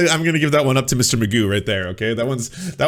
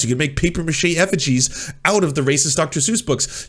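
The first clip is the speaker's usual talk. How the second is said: normal talking voice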